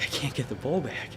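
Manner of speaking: hushed, breathy whisper of frustration; urgent whisper in the blind. Retold or imitated. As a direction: whisper so the deer won't hear. Struggling to draw bow near deer.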